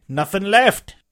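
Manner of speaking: high henchman voice; pirate reporting. A narrator's reading. Searcher at inn door to leader, high reply. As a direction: call it back in a high voice. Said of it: "said he".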